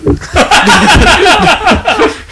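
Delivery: high shrieking laugh; loud laugh. Real, not acted. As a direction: burst out laughing. Big laugh.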